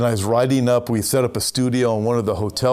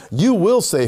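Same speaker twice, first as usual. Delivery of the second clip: YOU punched much higher and louder; strong emphasis; 8 semitones higher, 3 dB louder than usual. Acted. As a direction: hit YOU harder the second time. Emphatic repeated prophecy.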